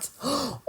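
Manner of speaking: high, soft intake of breath; quick, quiet gasp of surprise; surprised gasp. Real, not acted. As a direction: gasp in amazement. Reacts to seeing his stats.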